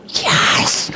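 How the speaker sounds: a triumphant little whisper; whispered cheer. Retold or imitated. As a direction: cheer under your breath. Teacher's triumph at ear training.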